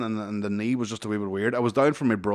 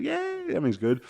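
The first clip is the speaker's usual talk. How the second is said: tipsy self; faster than usual. Retold or imitated. Imitating his tipsy self.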